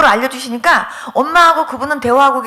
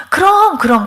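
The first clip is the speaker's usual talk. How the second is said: eager consent; 6 semitones higher, 4 dB louder than usual. Retold or imitated. Retold eager consent to praying.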